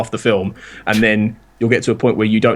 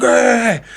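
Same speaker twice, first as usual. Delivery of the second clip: high, strained "argh" roar; hammed-up battle cry at moderate volume; mock action-hero yell; 13 semitones higher than usual. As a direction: pretend film-star shout, pitched way up. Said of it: "he's shouting and he's like".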